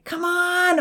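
high strained roar. A narrator's reading. Odin roaring at Brownie the cow, strained shout. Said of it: "Odin roared".

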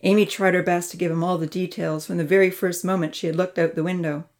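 usual speaking voice

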